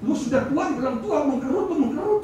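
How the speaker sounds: blunt teasing. Retold or imitated.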